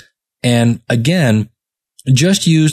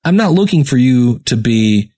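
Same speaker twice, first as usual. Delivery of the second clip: ordinary delivery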